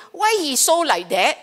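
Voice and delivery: indignant inner grumble; judging complaint. Acted. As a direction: complain as if you can't understand him. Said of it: "Rather than sitting down there going".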